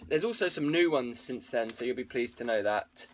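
usual speaking voice

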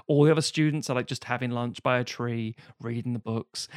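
ordinary talk, nothing marked

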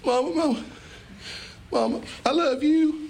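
put-on high, sweet begging child voice; pleading little kid; wheedling child. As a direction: sweet-talk mama like a kid after something. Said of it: "let's pretend like we're going to mama".

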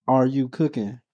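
ordinary delivery

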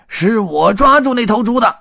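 high, full-volume angry claim; bellowing tramp staking his claim; roar over noise. A narrator's reading. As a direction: yell over a clattering train. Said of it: "红胡子的吼声盖过了火车发出的噪音".

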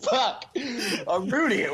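slow, laughing self-scolding; retelling his own panicked thought to friends, laughing slowly. Retold or imitated. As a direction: laugh through the realisation that you blew it. Realising he gave real name.

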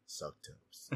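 whispered, voiceless confession. Real, not acted. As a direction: breathe the words out.

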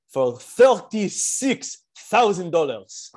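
mock rabbi drawling the sum; Rabbi Raskin's way of talking. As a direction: stretch out the big number like the rabbi does. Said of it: "this is how he talks".

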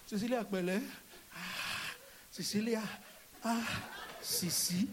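petting tone. Retold or imitated. Husband petting wife.

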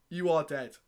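restrained, deliberate restatement; mock-grave poet, every word separate; slam poetry. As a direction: pull back from the shout, dead serious act. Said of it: "I'm gonna perform this poem".

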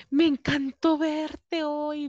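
delighted gushing. Retold or imitated. Neighbor's delighted farewell quoted.

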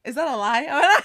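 laughing, high and loud. Real, not acted. Teasing her friend through laughter.

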